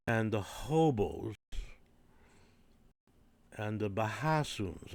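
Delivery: put-on slow, drawn-out Hungarian-accented English; lightly done Hungarian accent; Hungarian conductor accent. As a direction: say hobos and bahasuns like the old conductor. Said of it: "his accent was something we'd never heard before".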